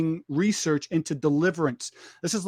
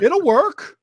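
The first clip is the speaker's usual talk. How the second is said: mock bravado; 8 semitones higher, 7 dB louder than usual. Inner thought, hammed up.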